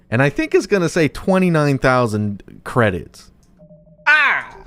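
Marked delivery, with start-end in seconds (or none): 4.1-4.5 surprised yelp. Real, not acted. Sees credits reward, yelps.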